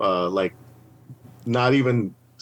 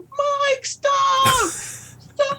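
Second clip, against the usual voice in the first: high frantic yelling of stop, far higher; panicked shout; much slower than usual. Retold or imitated. Talent captain stopping Mike spinning the can, frantic shout. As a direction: scream at him to quit. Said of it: "she was like".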